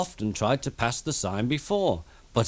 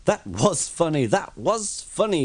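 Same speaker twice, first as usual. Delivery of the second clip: chuckling; 6 semitones higher than usual. A narrator's reading. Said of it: "he chuckled".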